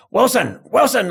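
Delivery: high mock yell of a name; crowd yelling. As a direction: shout the name up high, teasing. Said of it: "a whole yard of crims started going".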